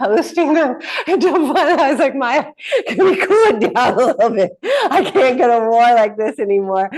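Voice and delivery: laughing, high and loud. Real, not acted. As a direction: laugh through the plea to tone it down.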